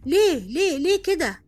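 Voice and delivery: high, loud reproach in a retold voice; loud scolding voice. Unseen voice in her ear over dropped peas, loud scolding. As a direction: imitate someone loudly telling you off. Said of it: "بصوت عالي بيقول لي".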